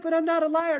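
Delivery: high, protesting imitation of a doubter; mock indignant listener. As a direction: play the offended objector. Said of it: "You're like".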